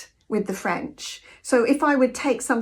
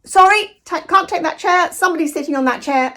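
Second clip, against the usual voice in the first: firm objection; 6 semitones higher, 6 dB louder than usual. Retold or imitated. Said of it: "somebody has to say".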